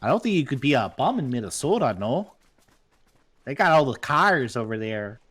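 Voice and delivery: put-on Minnesotan with rounded vowels; sing-song mock Minnesotan; Minnesota accent. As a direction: lay on a joking Minnesota accent. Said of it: "I was doing a Minnesota accent also".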